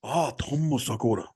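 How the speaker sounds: slow mock Japanese accent on Tom Segura; playful Japanese accent. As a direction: drawl the name in a put-on Japanese accent. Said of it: "It does sound very Japanese".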